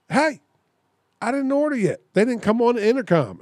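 high-pitched, indignant calling out; mock yell, pitched well up; retold yell back. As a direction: act out yelling back to the car behind. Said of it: "I yelled back".

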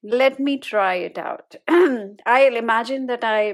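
ordinary delivery

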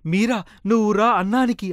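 calling Meera across the hut to come eat. A narrator's reading. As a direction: call him over to eat, loud and bright.

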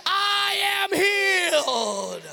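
loud, slow, proclaiming voice; full-force preaching. Acted. Preacher declaring God's word to the congregation, loud and slow.

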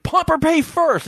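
high, insistent retold question. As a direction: repeat it as if to someone who missed it. Repeats because she didn't hear.

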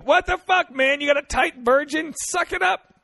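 high, loud outraged listener; high-pitched heckle at the guy; mock angry outburst. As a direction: yell it in exasperated mock anger. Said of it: "how many people are just angry".

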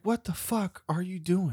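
can't-believe-this exasperation; exasperated disbelief. Retold or imitated. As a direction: ask it under your breath, appalled. Exasperated reaction to rowdy neighbours.

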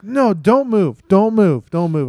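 loud, high retold panic, easing off; urgent mock-panicked command; flipping out. Dad freezes son mid-accident, re-enacted flipping out. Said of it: "I'm flipping out".

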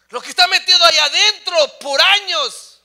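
loud, high shout; full-force preaching. Real, not acted. Preacher repeats what is buried for years, full-force shout. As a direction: hurl it out from the pulpit.

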